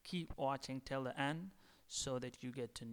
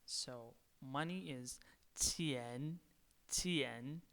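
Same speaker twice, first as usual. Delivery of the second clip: normal talking voice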